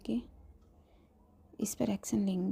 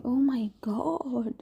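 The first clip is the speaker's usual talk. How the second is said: a drawn-out, higher, louder delighted cry; excited; 4 semitones higher, 4 dB louder, much slower than usual. Acted. Best friend gasping with joy at the news, excited. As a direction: stretch it out in joy. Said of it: "वो बहुत खुश होती है".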